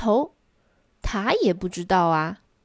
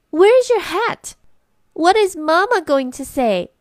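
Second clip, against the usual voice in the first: alarmed cry; 8 semitones higher, 7 dB louder than usual. A narrator's reading. Sister alarmed at Simon's lost hat, crying out. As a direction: shriek the worry about Mama. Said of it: "阿黛拉叫道".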